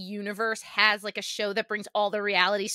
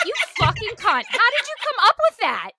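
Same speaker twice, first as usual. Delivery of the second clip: much higher, louder incredulous squeal; amazed outburst; 13 semitones higher, 5 dB louder than usual. Retold or imitated. Reacting to the girl's audacious line, amazed squeal. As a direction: shriek in delighted disbelief.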